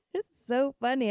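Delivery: normal talking voice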